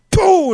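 very high, loud mock spit burst; spray it out like a sprinkler; explosive spit noise, played for laughs; spit sound. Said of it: "I gave the spit".

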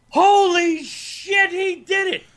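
high, drawn-out holler of disbelief; retold scream. Comic retelling his outburst at a playoff game, screamed. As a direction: belt it out, stretched and high. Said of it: "I scream".

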